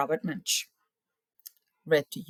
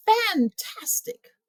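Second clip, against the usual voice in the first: impressed; 5 dB louder, much slower than usual. Acted. Praising the dragon.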